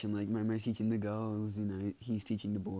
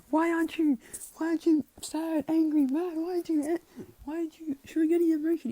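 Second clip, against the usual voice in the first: very high, loud acted rant; hammed-up shrieky demand, stopping and restarting; angry; 9 dB louder than usual. Angry girl demanding emotion from the boy, high loud mock rant. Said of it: "why she's angry or anything".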